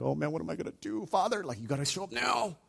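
imagined Jesus fretting to the Father, mock fear; mock trembling. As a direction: pretend to panic in prayer.

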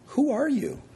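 high-pitched, incredulous challenge; pointed question. Acted. Preacher confronting Elijah about who he is, high and pointed. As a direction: ask it high, falling at the end.

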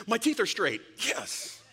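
hammed-up delighted bystander; mock excited crowd member. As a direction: gush like someone just healed. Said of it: "would be like".

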